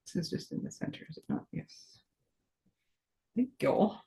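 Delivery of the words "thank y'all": a put-on Southern drawl, higher and soft; weird accent. Crafter thanking viewers in a put-on Southern accent. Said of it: "that weird accent came from".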